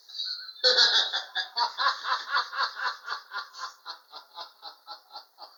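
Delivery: high, breathy burst of laughter; cracking up at his own punchline; long loud laugh; real laugh. Real, not acted.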